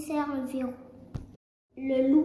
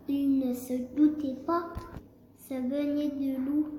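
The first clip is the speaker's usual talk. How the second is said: plain reading voice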